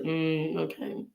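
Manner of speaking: soft, dull hum and okay; fake smile sound. Shown as a demonstration. Speaker showing viewers her fake polite smile, soft and dull. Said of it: "I'll do this. That's not a real smile".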